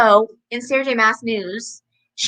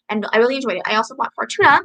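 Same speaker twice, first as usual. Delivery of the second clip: ordinary talk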